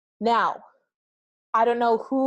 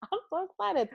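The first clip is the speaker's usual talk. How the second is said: much higher, bubbling over with excitement; excited; 11 semitones higher, 3 dB quieter than usual. Real, not acted. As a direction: squeal it with real glee. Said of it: "'cause I'm so excited".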